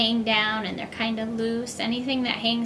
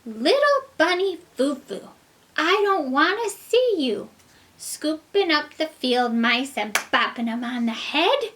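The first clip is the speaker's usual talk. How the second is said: fairy voice; 4 dB louder than usual. Acted. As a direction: scold like a stern fairy. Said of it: "and she said".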